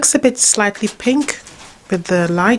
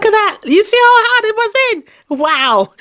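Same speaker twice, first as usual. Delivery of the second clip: much higher and louder, fast astonished; amazed; 13 semitones higher, 3 dB louder than usual. Real, not acted. Quilter showing viewers the stuck scissors, amazed and excited.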